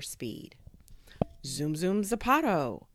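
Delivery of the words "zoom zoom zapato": rising, louder launch cry; excited catchphrase. A narrator's reading. Kid switching on super speed, excited rising cry. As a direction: build up to the last word and let it fly.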